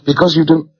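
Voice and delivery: DON'T left hanging, pitched high; high-pitched, cut short at DON'T; broken-off echo. A narrator's reading. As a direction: start repeating in disbelief, stop dead after DON'T. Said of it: "I broke off".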